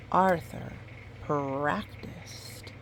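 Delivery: ordinary delivery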